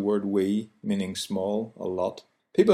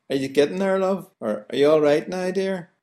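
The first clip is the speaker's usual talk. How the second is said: much higher, singsong Belfast shop voice; friendly counter staff; 8 semitones higher than usual. Retold or imitated. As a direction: chat up the customer warmly. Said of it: "The person behind the counter will say".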